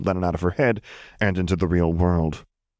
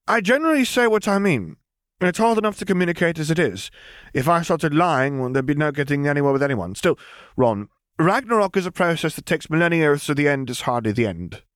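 put-on higher, matter-of-fact girl's voice; girl brushing off talk of a dead lord, higher; young girl Lily; 9 semitones higher than usual. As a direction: say it lightly, as if it's nothing. Lily explaining.

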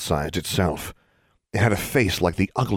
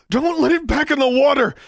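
gasping; 4 dB louder than usual. Acted. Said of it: "she gasped".

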